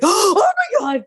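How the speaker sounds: high, loud gasping cry of alarm; mock panic. Grown-ups panicking over a toddler's fall, gasping alarm. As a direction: play the panicked onlooker, stretched out slowly.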